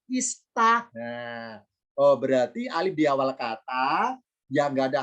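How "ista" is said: "ista" lifted high, starting on i, not a; Arabic vowel demo. Shown as a demonstration. Teacher showing students the alif stays i in "ista", pitched up. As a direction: say "ista" higher with an i sound. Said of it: "tapi tetap kasro".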